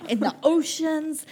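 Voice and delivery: hammed-up conspiracy theorist laying out the links; conspiracy theorist rant. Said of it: "one of those conspiracy theorists".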